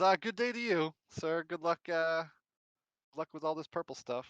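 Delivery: loud, high, overly formal goodbye; mock polite farewell. Harold to the purple man, mock polite goodbye. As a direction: tip the hat with a disappointed flourish.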